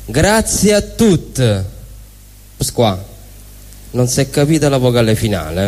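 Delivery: ordinary talk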